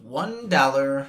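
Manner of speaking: ad announcer. Pretend. Mock sales pitch price reveal.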